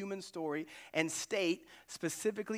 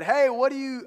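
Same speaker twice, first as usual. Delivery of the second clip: higher and louder, a mock pirate yell; captain's shout; 9 semitones higher, 11 dB louder than usual. The captain shouting at sleeping Jonah, mock pirate yell. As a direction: bark it out like a ship's captain in a storm. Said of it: "I imagine him talking like a pirate".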